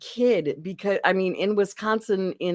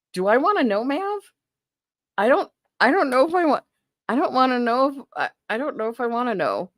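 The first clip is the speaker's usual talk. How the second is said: surprised; 8 semitones higher, faster than usual. Real, not acted. Wary of new snack.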